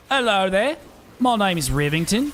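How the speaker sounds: mock posh English greeting that slips Australian; a failed English accent, drifting Australian; attempted English accent. Greeting chat in a mock English accent. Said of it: "that's a little Australian".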